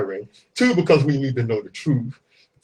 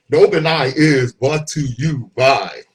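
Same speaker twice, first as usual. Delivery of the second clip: ordinary talk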